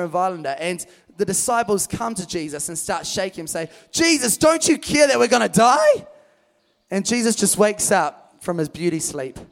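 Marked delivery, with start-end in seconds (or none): 3.9-6.1 loud, high panicked plea; panicked cry. Acted. Disciples to sleeping Jesus, loud panicked plea. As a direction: yell it like men about to drown.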